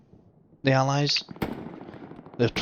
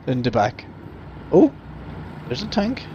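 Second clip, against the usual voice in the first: normal talking voice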